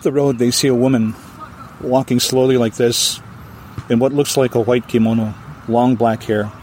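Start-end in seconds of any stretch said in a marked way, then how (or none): none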